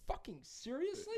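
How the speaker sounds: high squeaky disbelief; incredulous. Retold or imitated. Incredulous retold reply.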